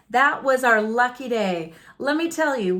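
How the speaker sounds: ordinary delivery, nothing marked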